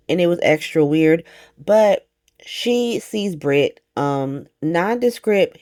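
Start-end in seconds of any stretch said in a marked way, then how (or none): none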